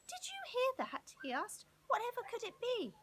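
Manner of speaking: high, startled questions; alarmed at the noise. A narrator's reading. Woken by screech.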